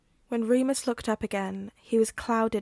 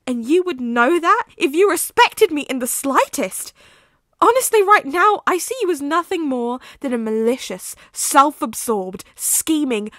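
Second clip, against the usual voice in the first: furious shouting; 3 semitones higher, 8 dB louder than usual. Acted. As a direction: tear into him, then break off. Remus explodes at Sirius.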